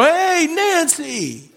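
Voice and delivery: put-on a jolly gatekeeper greeting an old friend; hammed-up Peter. Saint Peter greeting Frank at the gate, gushing and high.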